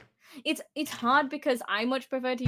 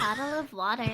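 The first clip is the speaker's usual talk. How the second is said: mock American pronunciation; American accent. Shown as a demonstration. Brit answering a friend's British take, mock American accent. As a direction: say it with American sounds. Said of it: "I'll become American".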